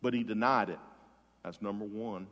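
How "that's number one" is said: usual speaking voice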